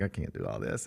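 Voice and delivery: mock overwhelmed, low and quiet. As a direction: sink into overwhelmed resignation. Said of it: "I'm like".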